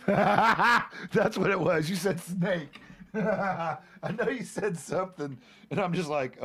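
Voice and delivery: loud, high, laughing recognition; helpless laughter through the words. Real, not acted. Cracking up as they remember.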